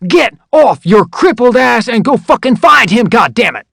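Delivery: high, loud barked order. Retold or imitated. Drill sergeant ordering the recruit to find his buddy, loud bark. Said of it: "barked even louder".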